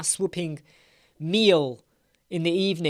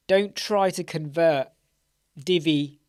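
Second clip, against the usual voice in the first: normal talking voice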